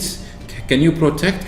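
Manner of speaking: ordinary talk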